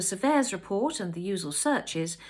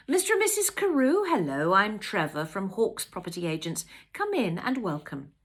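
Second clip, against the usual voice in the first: welcoming estate agent; 5 dB louder than usual. Acted. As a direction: greet clients like an eager agent. Estate agent greets arriving buyers.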